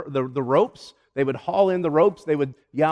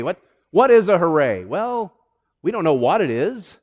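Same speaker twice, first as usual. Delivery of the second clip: usual speaking voice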